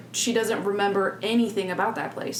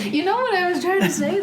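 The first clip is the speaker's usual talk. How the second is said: laughing protest; 10 semitones higher, 5 dB louder than usual. Real, not acted. Laughing defense.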